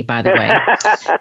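said through a real laugh. Real, not acted. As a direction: let the laugh spill into the words. Laughing at own remark.

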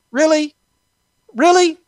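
high, loud disbelieving really; incredulous. Real, not acted. Podcaster scoffing at Fauci, high incredulous. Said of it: "incredulous repeat".

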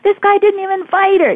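very high, loud hooting tease; imitated mockery. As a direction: pass on the joke in an incredulous squeal. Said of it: "guys laughing".

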